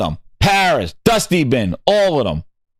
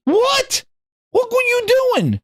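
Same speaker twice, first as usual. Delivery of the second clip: what and what were you doing squeaked much higher; incredulous high what. Real, not acted. Ranting at the blogger, squeaking up in disbelief.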